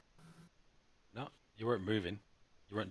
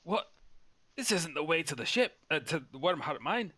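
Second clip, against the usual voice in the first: puzzled dwarf; 7 semitones higher, 3 dB louder than usual. Acted. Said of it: "he, he says".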